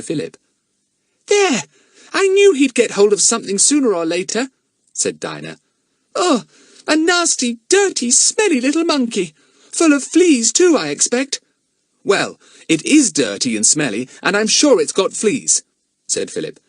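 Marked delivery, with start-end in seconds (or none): 1.3-4.5 put-on high, loud told-you-so girl; scornful girl. Dinah voiced, scornful. 6.1-11.4 put-on high, loud disgusted girl. Dinah disgusted by monkey. 12.0-15.6 put-on boy voice. Philip voiced.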